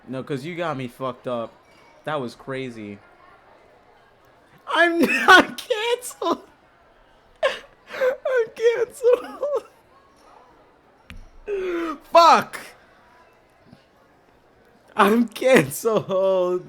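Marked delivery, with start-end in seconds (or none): 4.7-16.7 laugh-wailing. Real, not acted.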